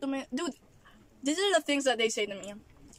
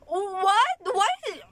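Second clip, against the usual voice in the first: loud, high-pitched incredulous questions; outraged disbelief; 7 semitones higher, 6 dB louder than usual. Real, not acted. Vlogger reacting to being called stupid and crazy, outraged. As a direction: shoot up in pitch and volume, appalled. Outraged at insults she just quoted.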